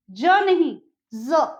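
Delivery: the first ज hard, the second buzzing like z, said slowly; ज versus ज़. Shown as a demonstration. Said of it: "इसके नीचे यहाँ बिंदी लगेगी". ("ज" और ज़ का फ़र्क धीरे-धीरे)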